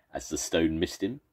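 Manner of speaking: ordinary delivery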